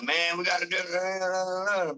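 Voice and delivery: slow, drawn-out whine; mock crew whine, stretched out; whining. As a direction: whine it like a reluctant deckhand. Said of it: "they all whine".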